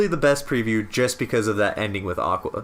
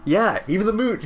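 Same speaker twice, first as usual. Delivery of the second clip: excited; 13 semitones higher, 5 dB louder than usual. Real, not acted. Spots moon symbol in trailer.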